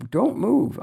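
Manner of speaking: high, loud insistent "don't move"; begging him to stay put; urgent plea. Retold or imitated. Wife begging husband not to leave Oregon, high urgent plea.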